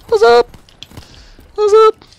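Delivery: jokey falsetto "what's up"; squeaky high greeting. Pretend. Greeting a chat viewer in a squeaky falsetto. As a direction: chirp the hello way up high.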